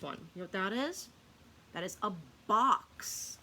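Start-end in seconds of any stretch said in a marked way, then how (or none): none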